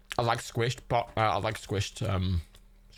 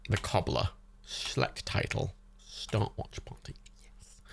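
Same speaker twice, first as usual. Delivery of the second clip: hushed, slow, fading into a whisper; muttering to self; 2 semitones lower, 5 dB quieter, slower than usual. Real, not acted. Reading menu options to self while clicking, whispered. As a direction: read it under your breath.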